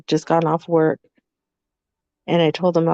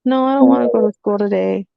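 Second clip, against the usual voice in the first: put-on whiny kid; 5 semitones higher than usual. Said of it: "And he's like".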